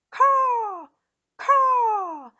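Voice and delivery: long, high, loud caws; creature caw. A narrator's reading. Giant lizards dive toward Eric, loud high caws. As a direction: screech like a swooping beast. Imitated cries of diving lizards.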